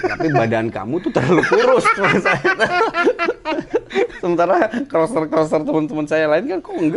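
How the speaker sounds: talking through laughter; laughing. Real, not acted. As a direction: chuckle through the words. Laughs retelling the doctor's remark.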